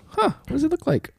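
high, quiet puzzled "huh"; curious fan voice. Retold or imitated. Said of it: "for these people to go".

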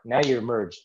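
ordinary talk

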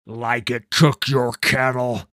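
loud, scornful disbelief; mocking accusation; sneering. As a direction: throw it back at him with contempt. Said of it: "Vanus sneered".

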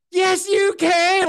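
high, loud, drawn-out cheer, imitating a room; urging shout. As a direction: egg her on slowly at full voice. Whole room urging her on.

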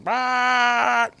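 high buzzing lip-trill motor noise; engine rumble imitation. Racing the boat across the lake, engine rumble imitation.

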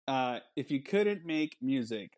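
ordinary delivery

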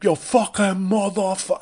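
loud, high New York yell, imitating; New York accent. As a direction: imitate a crass New York heckler yelling. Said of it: "atrocious New York Mike Francesa accent".

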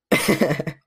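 bursts into real laughter; laughing. Real, not acted. Laughing after letting a name slip. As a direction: laughs at his own slip.

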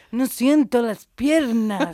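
high, loud, slow put-on whine; mock whiny guy. Mocking her ex's old catchphrase, high and loud. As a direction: parody a dramatic young man.

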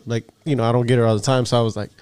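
ordinary delivery

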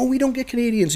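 very high-pitched mock surprise; imitated delighted surprise. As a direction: play an official thrilled by a rare visitor. Imitating officials surprised by Canadians.

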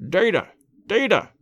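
mildly impatient exclamation. A narrator's reading. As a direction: cry out with a touch of impatience. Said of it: "he cried impatiently".

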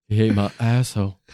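tongue held in fingers; holding tongue. Pretend. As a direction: talk while gripping your tongue. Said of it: "Why are you holding your tongue".

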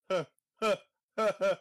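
high breathy mock giggle; little giggle. Fan imitates a character giggling at a rocket, high little giggle. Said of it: "He just does, like, this little giggle".

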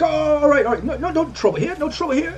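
high, hurried bandit voice backing down; surrendering bandit. Acted. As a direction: babble no trouble, pitched high and eager to give up. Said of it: "and he's like".

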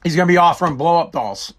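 normal talking voice

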